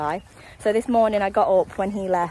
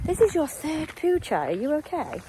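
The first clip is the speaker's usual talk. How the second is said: pet talk voice; 9 semitones higher than usual. Real, not acted. Talking to her dog on walk.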